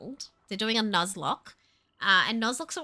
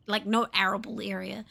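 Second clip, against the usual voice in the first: normal talking voice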